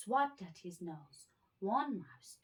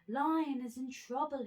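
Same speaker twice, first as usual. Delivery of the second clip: higher, louder alarm; alarmed cry; 8 semitones higher, 6 dB louder than usual. A narrator's reading. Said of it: "he cried".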